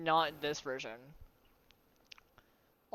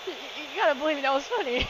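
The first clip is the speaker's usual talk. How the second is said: high, fast laughing plea; pleading fast through laughter; 7 semitones higher, faster than usual. Real, not acted.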